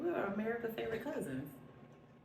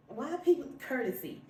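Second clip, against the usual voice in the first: ordinary talk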